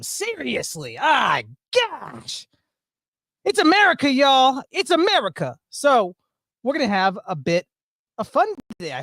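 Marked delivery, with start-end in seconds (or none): 3.4-5.6 high, loud hype; excited. Real, not acted. Streamer hyping his chat for America, excited. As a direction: proclaim it like a rally cry. Streamer declaring holiday spirit.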